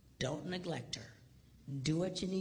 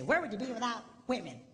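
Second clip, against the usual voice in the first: mock women; 7 semitones higher, 3 dB louder, faster than usual. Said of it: "Women say".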